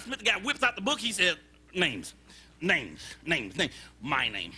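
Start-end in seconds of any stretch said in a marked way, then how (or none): 1.7-4.5 low, muttered-to-himself look-up; reading from notebook. Acted. Forgetful man searches his little book for his name, low reciting. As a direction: read off index headings in a low voice.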